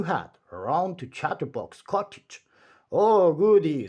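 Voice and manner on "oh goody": gleeful. A narrator's reading. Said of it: "said Mister Chatterbox".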